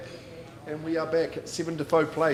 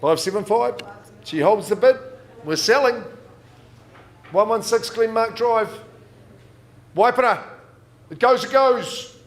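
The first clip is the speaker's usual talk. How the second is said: louder, higher rising sale call; excited auctioneer; 5 semitones higher, 8 dB louder than usual. Real, not acted. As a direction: build to the hammer drop. Hammer falls, sale climax.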